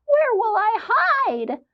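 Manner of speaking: high, slow panicky squeak-voice; a tiny mouse in a panic; frightened mouse. Acted. Mouse crying out fleeing the cat. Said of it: "cried the mouse".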